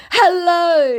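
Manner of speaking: high, loud mock hello; hammed-up scammer greeting. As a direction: sing it out like a fake charmer. Voicing the scammer.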